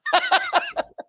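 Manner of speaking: real delighted laughing fit; high squealing laugh. Real, not acted. Host cracks up at the catchphrase, high squealing laugh. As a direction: burst into a high giggle.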